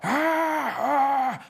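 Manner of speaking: high mock-primal "ah" yells; primal cry. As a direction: cry out like cartoon gladiators. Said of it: "very primal".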